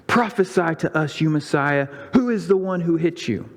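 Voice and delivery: high-pitched mocking taunt. A narrator's reading. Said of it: "slapped him and mocked him".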